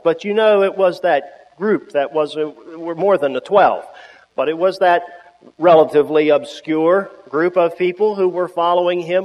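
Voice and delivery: ordinary talk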